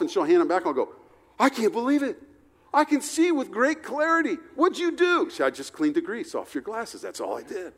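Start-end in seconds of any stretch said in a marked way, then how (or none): 1.4-5.3 much higher, delighted disbelief; amazed. Retold or imitated. Husband marvels to his wife at his clean glasses, high and amazed. 5.4-7.7 softer, dry and matter-of-fact; wife's quiet reply. Retold or imitated. Wife quietly tells her husband she only cleaned his glasses.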